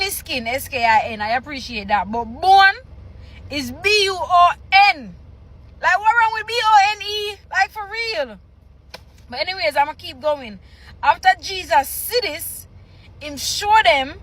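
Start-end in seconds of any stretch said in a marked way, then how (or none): 5.8-8.4 exasperated. Real, not acted. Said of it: "exasperated about spelling".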